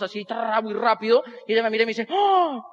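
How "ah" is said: high, astonished mock gasp; amazed gasp. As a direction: gasp as the impressed coworker. Coworker amazed at fast balloon twisting. (grito ahogado de asombro fingido)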